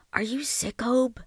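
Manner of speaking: high, soft anxious question; ask, hushed and afraid; worried. Acted. Frightened girl checks on brother.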